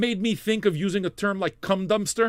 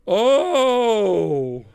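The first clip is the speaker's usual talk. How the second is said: a loud high yelp of delight, louder; sudden cry; 5 semitones higher, 6 dB louder than usual. Real, not acted. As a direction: burst out with a sharp loud oh. Sudden exclamation at a viewer.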